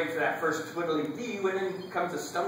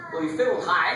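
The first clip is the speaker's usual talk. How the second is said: raised, louder pitch climbing into "high"; "high" sung out higher to mimic the fiddle; 6 semitones higher, 4 dB louder than usual. A narrator's reading. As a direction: lift the voice up on "high".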